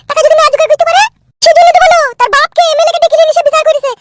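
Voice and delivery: shrill quarrel. Acted. Woman scolding neighbour, raised voice.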